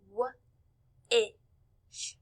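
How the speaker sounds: "W-ish" split into wuh, short i, sh; sounded out. Shown as a demonstration. Teacher asking class to listen, sounded out. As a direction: say "W-ish" sound by sound, long pauses between. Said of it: "Let's listen".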